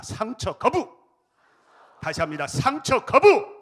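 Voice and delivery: loud, high slogan shout; rally slogan. Acted. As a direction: lead the crowd in a battle cry. Leading slogan again.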